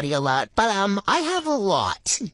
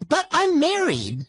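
high, slow, whiny objection; drawn-out high-pitched dismay; dismayed protest; 10 semitones higher, slower than usual. Acted. Distressed protest in odd voice.

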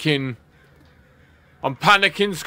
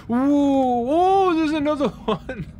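high, drawn-out spooked "ooh"; startled; 12 semitones higher, slower than usual. Real, not acted. Player spots another one in the horror game, startled.

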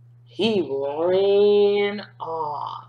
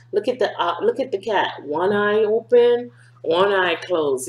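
usual speaking voice

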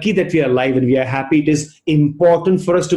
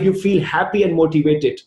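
ordinary talk

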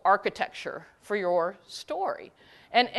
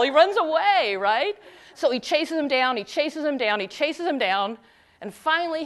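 usual speaking voice

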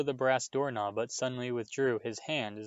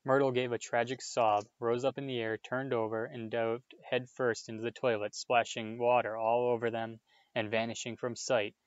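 plain reading voice

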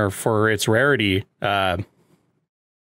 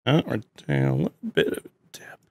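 whispering; 4 dB quieter, faster than usual. Real, not acted. Internet dropping, quiet aside.

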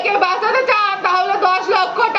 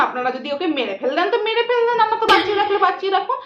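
rattled off quickly, uncaring; mother refusing to pay ransom; fast dismissive; faster than usual. Acted. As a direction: brush the kidnapper off quickly.

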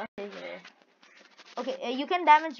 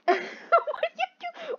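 high, slow squeaky monkey sounds; much slower than usual. Pretend. Friend asks why he sounds like a monkey. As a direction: screech like a monkey. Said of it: "Why are you sounding like a monkey".

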